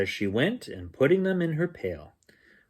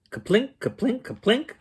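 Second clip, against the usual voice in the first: kaplink sound; 11 semitones higher than usual. Acted. Berries dropping in tin pail.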